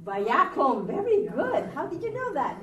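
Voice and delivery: high, loud burst of praise; thrilled teacher praising a reading; delighted praise. Real, not acted. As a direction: cheer the student on. Teacher delighted by correct answer.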